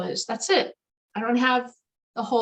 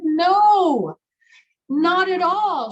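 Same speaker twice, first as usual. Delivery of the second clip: emphatic denial; 7 semitones higher, 8 dB louder, much slower than usual. Retold or imitated. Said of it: "And she said".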